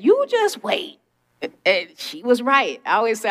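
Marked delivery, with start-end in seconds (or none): 0.0-1.0 much higher, knowing mother's voice; sing-song motherly told-you-so; mother's warning. Retold or imitated. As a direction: tease like a mom who knows better. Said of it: "My mother said".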